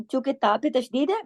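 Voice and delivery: ordinary delivery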